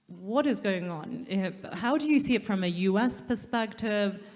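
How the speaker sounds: ordinary delivery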